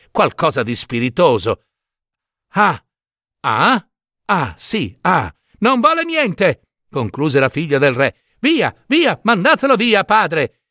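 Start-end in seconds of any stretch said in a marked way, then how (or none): 2.5-5.3 halting, isolated syllables with long gaps; lost for words. Acted. Tongue-tied suitor before the king's daughter, lost for words. 5.6-6.6 high-pitched dismissive princess; scornful verdict. Acted. King's daughter rejects the tongue-tied suitor, scornful. 8.4-10.6 high-pitched, impatient shooing; imperious dismissal. Acted. King's daughter tells her father to remove the suitor, imperious. Princess has suitor sent away.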